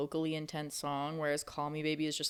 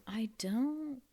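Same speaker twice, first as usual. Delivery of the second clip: whispering; 5 semitones higher than usual. Pretend. Confused foreigner voiced, whispered.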